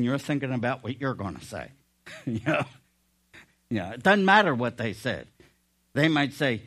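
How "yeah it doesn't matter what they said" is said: usual speaking voice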